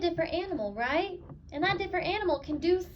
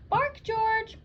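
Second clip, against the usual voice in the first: mother's command; 8 semitones higher, 4 dB louder than usual. Acted. Mother voiced in read-aloud.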